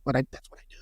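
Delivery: sheepish mumble. Retold or imitated. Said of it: "It's like".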